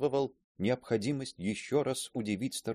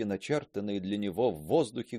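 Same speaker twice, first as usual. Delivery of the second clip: ordinary delivery, nothing marked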